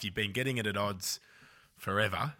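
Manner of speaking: usual speaking voice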